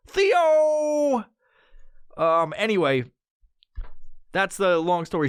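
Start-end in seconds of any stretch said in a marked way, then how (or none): 0.1-1.2 calling out. Real, not acted. Calling out to someone.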